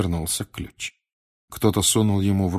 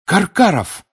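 high, loud outburst; shouted out; 11 semitones higher, 8 dB louder, slower than usual. A narrator's reading. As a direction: cry out the culprit's name. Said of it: "выкрикнул Гарри". (громкий высокий выкрик)

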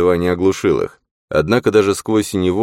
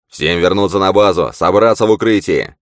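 loud, higher orders barked over the radio; yelling into a radio; 4 semitones higher, 5 dB louder than usual. A narrator's reading. Costa yells to everyone over the radio to return to base, loud. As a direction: yell it into the radio over the explosions. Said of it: "орал в рацию".

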